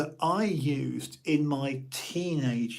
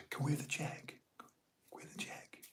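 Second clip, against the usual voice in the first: softer, mouthed whisper; whispered request; 14 dB quieter than usual. Retold or imitated. Asking the waiter for the check, mouthed whisper. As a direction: mouth it quietly across the room. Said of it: "you just sort of say".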